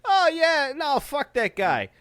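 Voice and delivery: high, loud New York comic impression; New York accent, slowing on the kiss-off; put-on New York accent. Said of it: "sound like I fucking had a New York accent".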